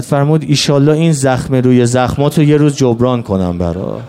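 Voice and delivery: keen the martyrdom tale, building to a cry; mourning lament. Acted. Rowzeh lament, rising to wail.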